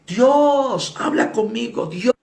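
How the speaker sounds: high, drawn-out cry to God; shouting. Retold or imitated. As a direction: imitate a man yelling a challenge skyward. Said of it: "empezó a gritar".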